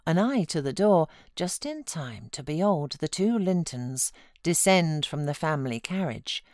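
usual speaking voice

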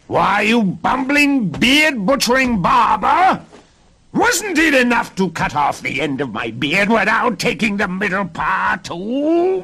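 furious storybook dwarf; outraged scolding. A narrator's reading. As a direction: rant in a screechy little-man voice. Dwarf scolds girl for cutting beard.

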